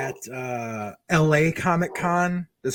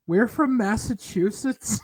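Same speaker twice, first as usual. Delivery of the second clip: put-on slow, timid kid's answer; mild imitation of a frightened fan; nervous kid; 5 semitones higher, slower than usual. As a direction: squeak it out like a scared kid. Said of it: "turns and looks at him and goes".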